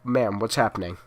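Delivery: ordinary delivery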